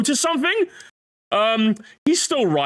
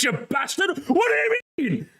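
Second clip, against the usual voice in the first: normal talking voice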